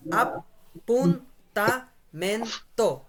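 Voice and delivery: "appuntamento" slowly, each syllable set apart. Shown as a demonstration. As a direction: sound out "appuntamento" as ap, pun, ta, men, to. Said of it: "এটা syllable আকারে পড়বো আমরা".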